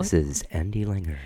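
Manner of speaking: light take on a radio voice; understated DJ sign-on, slightly put on; Andy Langer. Podcaster mimicking a radio host's sign-on, lightly done radio voice. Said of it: "radio voice is a thing".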